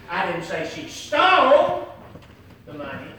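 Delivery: "stole" loud and rising; "stole" hit hard; stress on stole. Shown as a demonstration. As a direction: punch "stole", then drop "the money". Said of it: "Let me say it in different ways".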